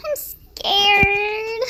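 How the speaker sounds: drawn-out whine into rising fake cry; mock-tearful whimper; scared. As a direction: wail it in pretend fright.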